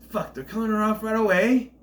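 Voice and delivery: high, loud disbelief; shocked reaction. Retold or imitated. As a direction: blurt it out in disbelief. Acted shocked reaction to film.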